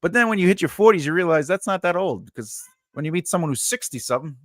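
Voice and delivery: usual speaking voice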